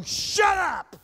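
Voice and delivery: high, loud shout of "Shut up". Acted. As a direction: snap it at the pestering students. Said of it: "Same thing".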